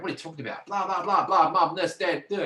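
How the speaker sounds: high-pitched mock protest; defensive babbling protest. Patient mocks own reply to doctor's question, defensive babble. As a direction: babble back in an exaggerated, indignant imitation.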